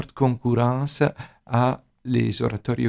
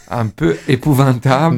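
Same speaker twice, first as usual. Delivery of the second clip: laughing; 3 semitones higher, 8 dB louder than usual. Real, not acted. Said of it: "je m'excuse, mon français".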